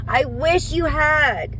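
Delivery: high, loud and slow, biting off each word; fed-up ex venting her fury; angry outburst. Real, not acted. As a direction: spit it out in real anger. Angry rant at the other women.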